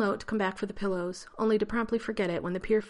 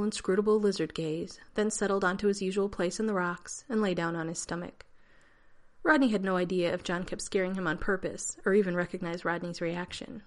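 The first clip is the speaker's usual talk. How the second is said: usual speaking voice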